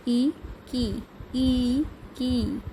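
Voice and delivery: "ई" and "की" stretched very slowly; slow sound drill. Shown as a demonstration. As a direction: draw out each sound "की" for children. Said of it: "ऊ कु". ("की" को बहुत धीरे खींचकर बोलें)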